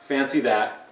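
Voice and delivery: ordinary talk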